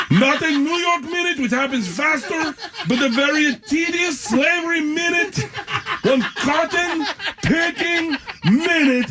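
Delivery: high, laughing mock announcement, slow at the end; mock the stage manager while cracking up; stage manager impression, laughing.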